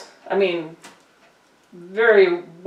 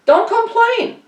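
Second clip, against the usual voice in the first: mock scolding; 3 dB louder, slower than usual.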